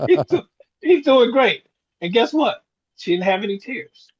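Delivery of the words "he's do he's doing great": laughing through a fib. Retold or imitated. Son laughing as he fibs to his mother that Dad is fine. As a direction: laugh the words out while covering for him.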